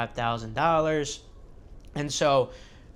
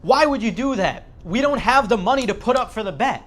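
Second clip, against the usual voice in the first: high, loud retold outburst at Joseph; angry uncle shouting in disbelief; furious scream; 8 semitones higher, 7 dB louder than usual. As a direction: yell it at the top of your lungs. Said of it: "screams at the top of his lungs".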